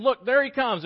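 high, loud cry of delight; excited bride. Acted. Bride at the door spots groom, high and loud. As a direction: shout it as he comes into view. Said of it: "She's at the front door waiting".

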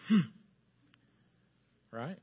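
ordinary delivery

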